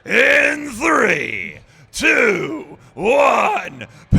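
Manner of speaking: huge high wrestling ring announcer shout. Pretend. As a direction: bellow the countdown like a ring announcer. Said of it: "count 'em in with your best wrestling voice".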